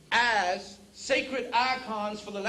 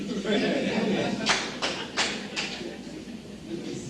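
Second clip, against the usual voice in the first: laugh. Real, not acted. Audience laughter after joke.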